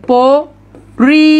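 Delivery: প and রি stretched long, split by a pause; slow syllable-by-syllable demo of প রি; sounded-out syllables. Shown as a demonstration. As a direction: say প, pause, then a long, loud রি. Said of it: "পরি উচ্চারণ করেন, পরিষ্কার বানান উচ্চারণ".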